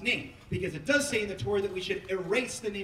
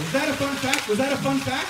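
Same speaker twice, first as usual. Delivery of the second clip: loud rallying question; 5 semitones higher, 6 dB louder than usual. Real, not acted. Asks the crowd repeatedly.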